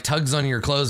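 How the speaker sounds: ordinary talk, nothing marked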